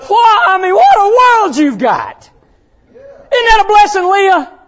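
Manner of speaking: high, loud preacher's exclamation; full-force preaching. Real, not acted. Preacher to Leah on the blessings she has, full-force preaching. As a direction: call it out across the church.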